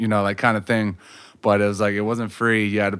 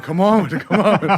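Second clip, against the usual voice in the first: slow, higher, laughing come-on of a big man squaring up; mock taunt; 8 semitones higher, 4 dB louder than usual. Big rapper squaring up to the cook, playful mock taunt. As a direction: bait him like a matador, chuckling.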